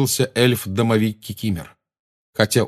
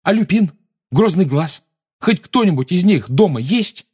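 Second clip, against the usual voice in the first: desperate; 10 semitones higher, 5 dB louder than usual. A narrator's reading. Desperate questions.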